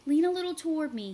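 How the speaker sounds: fast, loud urging. A narrator's reading. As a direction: urge her closer. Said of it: "he urged".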